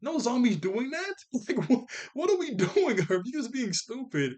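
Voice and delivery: high, loud, half-laughing protest; exasperated rant. Real, not acted. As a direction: argue in amused disbelief, pitch climbing. Arguing with Herb's logic, exasperated.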